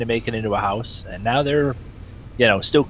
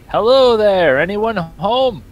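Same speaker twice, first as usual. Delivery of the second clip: loud, high call with drawn-out words; 8 semitones higher, 5 dB louder, slower than usual. Acted. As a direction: holler to see if anyone's home. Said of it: "gonna shout out".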